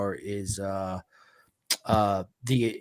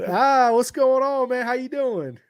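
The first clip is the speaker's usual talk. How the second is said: excited greeting; 12 semitones higher, 5 dB louder than usual. Real, not acted. Greeting friend's dad on arrival.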